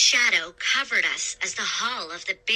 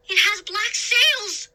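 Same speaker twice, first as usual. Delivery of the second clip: high, slow, excited cry; exclaiming; 11 semitones higher, 3 dB louder than usual. A narrator's reading. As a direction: announce it wide-eyed. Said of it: "Sean exclaimed".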